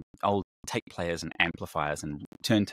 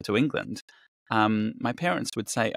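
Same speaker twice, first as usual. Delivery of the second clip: ordinary talk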